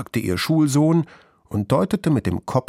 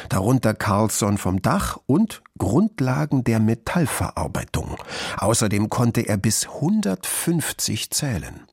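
ordinary delivery, nothing marked

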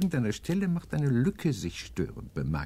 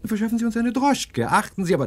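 much higher, louder urgent order; urgent command; 13 semitones higher, 6 dB louder than usual. Acted. Professor urges Hedge to fetch a cab, urgent. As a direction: send him off in a hurry.

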